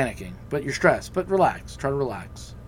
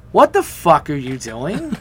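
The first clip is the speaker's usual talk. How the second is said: laughing through an outraged question; slow, laughing mock shout of disbelief; laughing outrage; 2 semitones higher, 6 dB louder, slower than usual. Shocked hosts, laughing retold.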